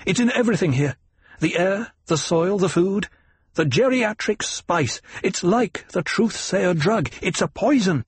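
high, urgent, mounting alarm; agitated revelation. A narrator's reading. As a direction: insist on the danger, item by item.

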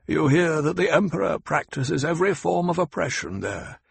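Hawat, sharp and knowing. Acted. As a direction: lay out the facts to corner him. Hawat lectures the Baron.

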